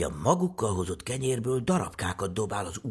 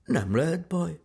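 put-on higher-pitched boy voice; higher, reassuring child's tone; boy reassuring his sister; 9 semitones higher than usual. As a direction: lightly voice a confident little boy. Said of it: "szólt Jancsi".

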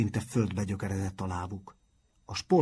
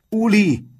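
much higher, much louder cry of a name; desperate shout; 13 semitones higher, 13 dB louder than usual. A narrator's reading. Matthias yelling to Uli on the ladder, desperate shout. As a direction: scream the name to stop him. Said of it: "kiáltotta Matthias".

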